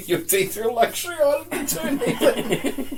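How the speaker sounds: high, loud, drawn-out mock threat through laughter; laughing mimicry. Guest echoes co-host's teeth threat, laughing mimicry.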